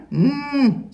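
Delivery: high, humming seal moan; drawn-out animal mm; mother seal call. A narrator's reading. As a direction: hum a call like a grown seal. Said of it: "said the seals".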